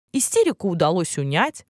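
plain reading voice

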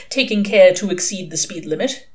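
ordinary delivery

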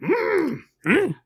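high, strange little creature voice; squeaky wise old creature; Yoda voice. Pretend. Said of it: "three Yodas all talking".

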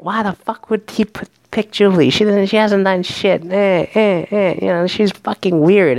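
put-on outraged complaining; indignant griping. Voicing the girls griping about Jubilee.

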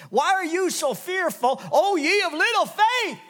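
high, loud rebuke; Jesus reproving the disciples, loud and high. Acted. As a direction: scold them for little faith. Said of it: "This was like a reproving".

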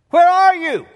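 high, loud call into the dark. Retold or imitated. Husband searches the rain for the drunk, calling loudly. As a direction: shout to find someone unseen.

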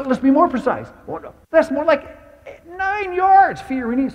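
very high, loud taunting coach; mock the player like a sneering coach. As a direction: coach taunting Fiorini over game film, shrill and loud. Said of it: "And Molman said".

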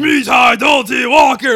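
"Mi tai do ti walker" yelled high and loud, drawn out; screamed. Retold or imitated. Imitating the monster screaming in the movie, loud shout. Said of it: "Remember when he screams it in the movie".

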